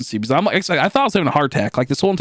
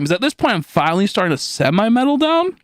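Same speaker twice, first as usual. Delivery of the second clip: ordinary talk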